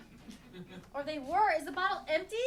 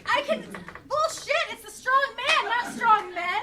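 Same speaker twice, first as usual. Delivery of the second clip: indignant shout; 7 semitones higher, 8 dB louder than usual. Acted. Indignant carnival argument, shouting.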